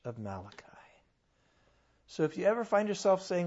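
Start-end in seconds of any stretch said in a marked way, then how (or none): 0.1-0.6 dropped to a whisper; whispered emphasis on the message. Real, not acted. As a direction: whisper it for weight. Sudden whisper.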